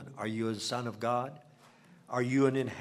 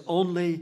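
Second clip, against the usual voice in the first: only pitched up and louder; stress on only; 5 semitones higher, 7 dB louder than usual. Shown as a demonstration. Preacher reads King James only to the church, stressing it. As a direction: lift only above the rest. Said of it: "I want to emphasize that word only".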